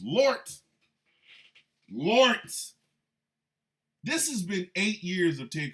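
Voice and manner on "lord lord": loud, high cry of exasperation; short, loud, raised-pitch outburst; exasperated exclamation. Real, not acted. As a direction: call out to heaven, exasperated. Exclaims over Ted Cruz.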